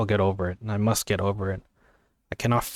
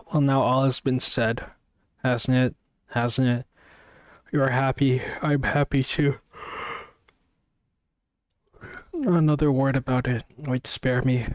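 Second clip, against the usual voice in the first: reading on, pitched up and close to tears; real emotion catching in the reader's throat; choked up; 3 semitones higher than usual. Real, not acted. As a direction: voice rising as real tears come. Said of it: "can you give me a second for me to wipe my eyes".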